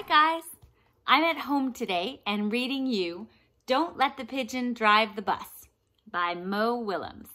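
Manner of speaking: ordinary talk, nothing marked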